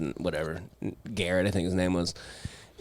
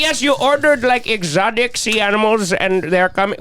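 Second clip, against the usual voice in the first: put-on a light, high Indian accent on a prank call; mock Indian accent; 9 semitones higher, 3 dB louder than usual. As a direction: play a fake Indian sales agent, lightly. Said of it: "a fun Indian accent".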